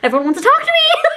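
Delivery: high squealing laugh-talk; giggly high-pitched disbelief. Real, not acted.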